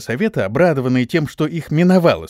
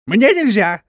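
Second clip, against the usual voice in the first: high, loud startled yelp; squealing; 8 semitones higher, 5 dB louder, slower than usual. A narrator's reading. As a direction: squeal it as if just woken. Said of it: "взвизгнул проснувшийся мастер". (визгливо, высоко и громко)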